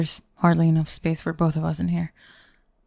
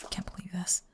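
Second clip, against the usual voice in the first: whisper; 12 dB quieter than usual. Acted. Whispered disbelief.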